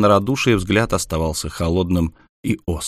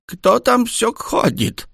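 high, slow creaky granny voice; quavering old woman; much slower than usual. A narrator's reading. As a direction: rattle it out like an old grandmother. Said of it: "дребезжащий старческий голос".

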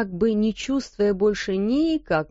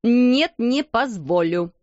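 loud cry, then slow hard refusal; furious refusal; 3 semitones higher, 3 dB louder, slower than usual. Acted. Headmistress refuses the maid's plea, loud and furious. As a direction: slam the request down. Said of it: "вскричала она".

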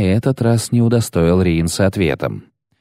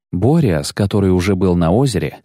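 plain reading voice

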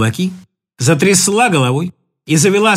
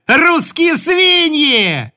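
slow, higher, full shout; 8 semitones higher, 3 dB louder, much slower than usual. Acted. Mainat screaming her insult at the Russians. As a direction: bellow the insult long and loud.

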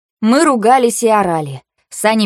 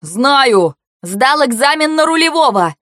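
raised, high-pitched retort; defensive shout; 6 semitones higher, 3 dB louder, slower than usual. A narrator's reading. Driver defending his skill to angry passenger, shouting. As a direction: shout back in self-defence.

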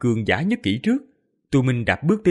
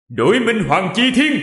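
higher, loud, thundering decree; booming command; 9 semitones higher, 6 dB louder than usual. Acted. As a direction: command the heavens to change. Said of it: "Giọng Tô Minh vang vọng khắp tinh cầu". (hô vang như sấm)

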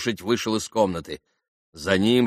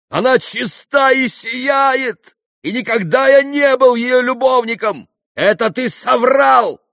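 high, loud impassioned shout; crying out; 10 dB louder than usual. A narrator's reading. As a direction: shout her defence with all your force. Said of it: "возопил Митя". (страстный вопль в её защиту)

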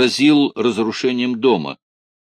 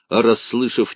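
plain reading voice

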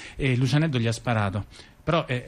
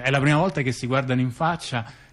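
normal talking voice

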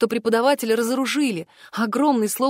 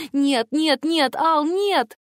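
rising, pitched-up pleas; desperate protest; 5 semitones higher, much slower than usual. A narrator's reading. Girl protests as she is torn from Al, desperate. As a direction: climb higher with every refusal.

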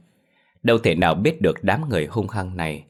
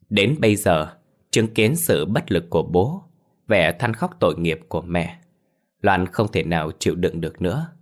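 ordinary delivery, nothing marked

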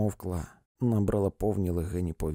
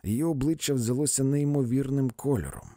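usual speaking voice

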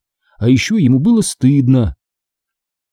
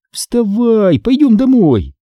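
high, urging order; commanding; 11 semitones higher, slower than usual. A narrator's reading. Sister ordering brother off the grass, commanding. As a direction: order someone up, pitched high. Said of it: "велела я".

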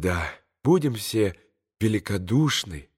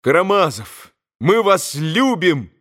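irrepressible exclamation; 6 semitones higher, 4 dB louder than usual. A narrator's reading. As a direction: blurt it out as a devoted boy. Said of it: "воскликнул неудержимо один голос".

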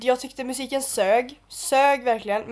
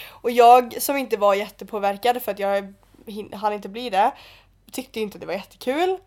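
ordinary talk

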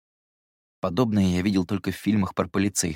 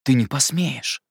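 slow, higher-pitched indignant protest; 6 semitones higher, 4 dB louder, slower than usual. A narrator's reading. Narrator protests Jerome's threat, indignant. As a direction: stretch it out, outraged and higher. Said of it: "чуть не задохнулся от негодования".

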